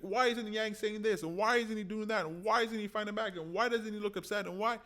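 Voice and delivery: high, exasperated string of complaints; mock-exasperated questions at the candidate; frustrated supporter whining. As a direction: whine each why like an impatient fan. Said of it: "You're like".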